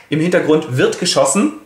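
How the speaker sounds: ordinary talk, nothing marked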